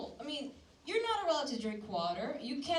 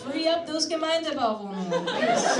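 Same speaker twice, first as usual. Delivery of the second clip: much louder, high comic yell; mock shouted demand; 3 semitones higher, 8 dB louder than usual. Comic yelling at old Austrians to free apartments, loud mock demand. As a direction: bellow it like a protest chant.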